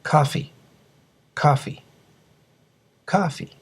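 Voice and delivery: "coffee" with an open American "ah" in the first syllable; careful listening model of "coffee"; English pronunciation model. Shown as a demonstration. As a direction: say "coffee" as KAH-fee for learners. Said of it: "Please listen carefully".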